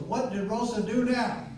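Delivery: loud, high, drawn-out mock outcry; exasperated husband. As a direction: act out an exasperated husband calling out. Husband confronts sheriff at porch.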